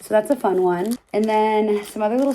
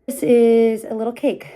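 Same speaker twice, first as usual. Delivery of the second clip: slightly out of breath talking; breathless from talking a lot; 3 semitones higher, 6 dB louder than usual. Real, not acted. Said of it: "Sorry, I'm out of breath".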